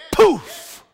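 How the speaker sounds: high, loud exclamation; loud poof. Real, not acted. Preacher shouting poof to the congregation.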